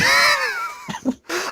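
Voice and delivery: shrieking laugh; high burst of laughter. Real, not acted. Laughing at his own story.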